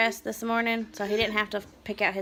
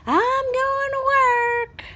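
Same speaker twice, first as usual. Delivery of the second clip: high, cheery sing-song goodbye; chirpy husband off to work; lightly mocked cheerful husband; husband's voice; 7 dB louder, slower than usual.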